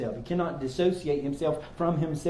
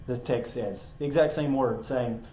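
ordinary talk, nothing marked

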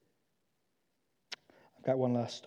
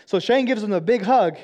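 ordinary talk

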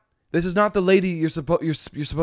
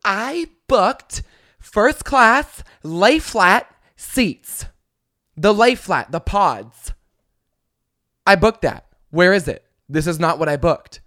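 entitled complaint; 3 semitones higher, 5 dB louder, slower than usual. Retold or imitated. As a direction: whine it out like a spoiled passenger. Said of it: "And she goes".

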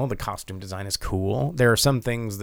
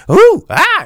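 loud, high mock whoop; Momoa yell; 14 dB louder than usual. Podcaster imitating Momoa's yell, high and loud. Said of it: "he does that".

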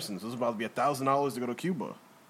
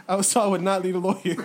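laughing while talking; high, loud words through laughter; 8 semitones higher, 6 dB louder than usual. Real, not acted. Joking about Epstein's lawyer promise.

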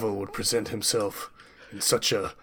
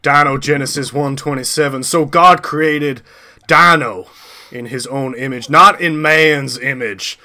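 preaching; 4 semitones higher, 9 dB louder than usual. Acted. Character preaches scripture.

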